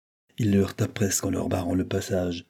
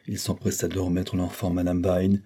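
plain reading voice

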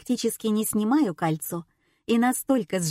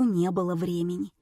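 ordinary delivery, nothing marked

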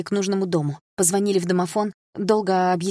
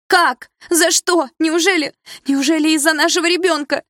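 very loud, very high wail; hysterical cry; 11 semitones higher, 8 dB louder than usual. A narrator's reading. Mother crying out about her unborn child, hysterical. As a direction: cry out in despair.

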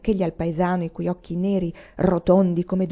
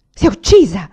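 loud, shocked question; horrified shout; 11 dB louder than usual. A narrator's reading. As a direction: cry it out in horror. Guesses his mother killed herself.

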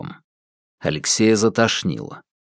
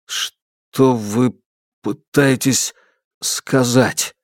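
halting, words broken by pauses; voice failing; much slower than usual. Acted. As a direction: struggle to get the question out. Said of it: "Голос не слушался". (запинаясь, голос не слушается)

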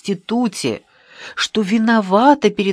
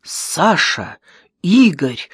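understated, strained call of names; a touch of cracked distress; 4 dB louder, much slower than usual. A narrator's reading. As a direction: call out as if not yourself. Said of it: "каким-то не своим натреснутым голосом".

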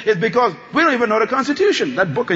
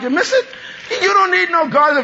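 shout; 7 semitones higher than usual. Shown as a demonstration. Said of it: "That's a good time to shout".

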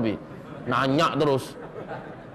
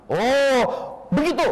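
surprised; 7 semitones higher, slower than usual. Acted. Rasul surprised at good deed.

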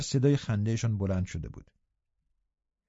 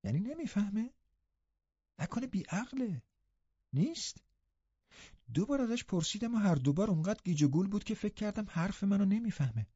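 put-on much higher, much softer girl's voice, puzzled; earnest question; 7 semitones higher, 6 dB quieter than usual. Catherine seriously asks if Hareton is simple, high and soft. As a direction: ask in earnest, wide-eyed. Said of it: "خیلی جدی پرسید".

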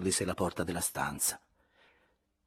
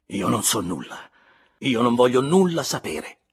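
hard, louder answer; harsh reply; 2 semitones higher, 9 dB louder than usual. A narrator's reading. Andrea answering Elena harshly, louder. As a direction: throw the words back hard. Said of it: "rispose Andrea duramente".

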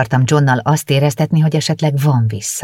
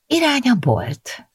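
cheerful; 12 semitones higher than usual. A narrator's reading. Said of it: "füttyentettem jókedvűen".

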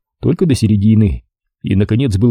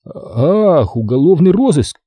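high-pitched "aha" of understanding; sudden realisation; 6 semitones higher, slower than usual. A narrator's reading. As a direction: let it dawn, pitch lifting. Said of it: "осенило меня".

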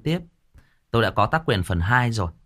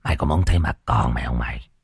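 lower, rough gangster threat, lightly voiced; Long Mộng Gà catchphrase; 8 semitones lower than usual. Storyteller quotes his idol's famous line in a low hoarse voice. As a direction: growl the threat like a hoarse gang boss. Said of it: "đúng câu nói quen thuộc của anh Long".

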